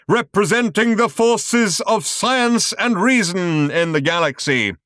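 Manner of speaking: slow, high, loud showman voice; grand announcement. A narrator's reading. Showman announces the pillar to the audience, grandly. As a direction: announce it like a ringmaster. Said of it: "announced the man".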